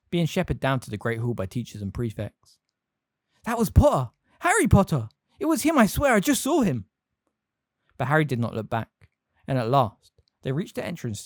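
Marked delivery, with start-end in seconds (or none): 3.4-6.9 excited. A narrator's reading. Students excitedly spotting Harry.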